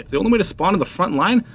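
ordinary delivery

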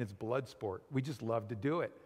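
normal talking voice

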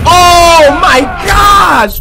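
slow, loud and high shocked shout; real gamer shock, yelled. Real, not acted. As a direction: shout it out, stretched long. Big hit in game.

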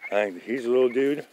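ordinary talk, nothing marked

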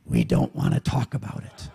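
low, dark, clipped refusal in a low voice; gruff evasive raftmates. Acted. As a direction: play the raft crowd shutting the question down, low. Raft people deflecting the question.

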